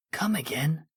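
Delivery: breathy unvoiced whisper; whispered disbelief. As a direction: hush it out, stunned. Stunned hero asks in disbelief.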